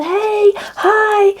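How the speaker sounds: high, sugary fake-friendly greeting; fake nice guy. Husband's friend greeting him, mock sweet. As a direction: mimic a phony nice guy saying hi. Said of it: "he acts like the nicest guy".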